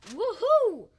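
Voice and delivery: high, loud whoop of delight; gleeful whoop. Acted. Man opening the box of money, gleeful whoop. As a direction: let out a big swooping cheer.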